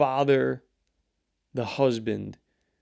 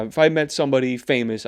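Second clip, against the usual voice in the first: ordinary delivery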